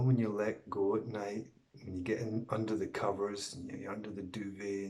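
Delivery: usual speaking voice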